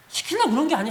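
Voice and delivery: ordinary talk, nothing marked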